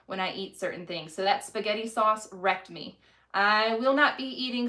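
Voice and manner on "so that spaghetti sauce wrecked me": ordinary talk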